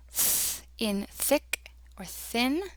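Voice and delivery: "th" as a long voiceless hiss, as in "thick"; soft TH. Shown as a demonstration. Teacher introducing the special sound, soft voiceless TH. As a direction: isolate a breath-only "th", then say "thick or thin" slowly. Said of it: "This is the soft T H sound".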